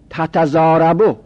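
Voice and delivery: ordinary delivery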